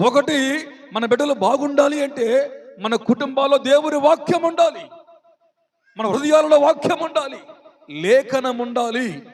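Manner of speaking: loud, high, driving sermon; fervent pulpit exhortation; full-force preaching. Real, not acted. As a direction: hammer each point home to the congregation.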